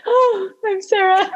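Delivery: high, giggly sign-off; laughing sign-off. Real, not acted. Guest signing off the show while laughing. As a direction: introduce yourself through laughter. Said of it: "laughing sign-off".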